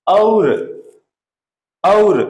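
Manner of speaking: clear, drawn-out demo of और, said as a pure o; pronunciation demo. Shown as a demonstration. Teacher to pupils, loud careful demo of और as o. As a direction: spell out और so every student hears it as o. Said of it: "जब ये बोलेंगे तो हमको यही बोलना पड़ेगा".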